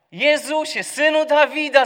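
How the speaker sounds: high, loud desperate cry. Acted. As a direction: shout for mercy across the crowd. (głośne, wysokie wołanie o litość)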